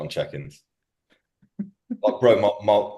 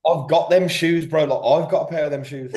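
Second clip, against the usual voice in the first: exasperated retort; 6 semitones higher, 3 dB louder than usual. Retold or imitated.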